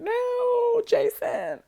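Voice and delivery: high, wailing no, hammed-up; mock wailing mother. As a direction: squeal it like a desperate mom. Mother pleading, held back.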